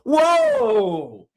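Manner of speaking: high, drawn-out mock alarm; hammed-up shocked objection; mock outrage. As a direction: wail it in exaggerated protest. Mocking outraged reaction to quote.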